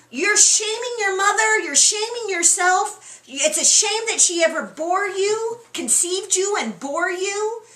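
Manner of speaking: mock angry rant. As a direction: berate him like a furious king. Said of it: "and he's saying".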